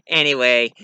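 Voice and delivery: plain reading voice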